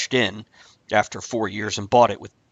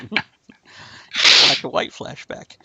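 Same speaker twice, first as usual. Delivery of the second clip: breathless laugh through words; 6 semitones higher, 7 dB louder, much slower than usual. Real, not acted. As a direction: laugh it out slowly. Laughing at cow joke.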